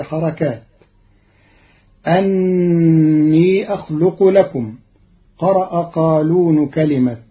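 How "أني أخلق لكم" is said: أني chanted with an open a, anni; slow melodic tajweed; Quran recitation. Shown as a demonstration. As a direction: chant it slowly. Verse recited before the rule.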